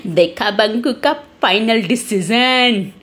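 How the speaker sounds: slow gloating line after a laugh; acted triumphant childish laugh; laughing boy. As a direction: gloat with a chuckle, stretching the last word. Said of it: "Banku हंसने लग जाता है".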